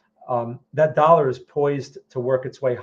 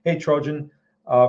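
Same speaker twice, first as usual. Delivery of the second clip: ordinary talk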